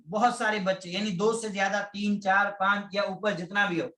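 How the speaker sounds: normal talking voice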